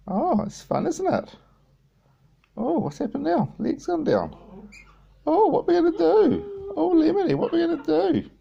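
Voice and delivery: squeaky high voice for the bird. Real, not acted. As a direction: coo up high at the budgie. High pet talk to bird.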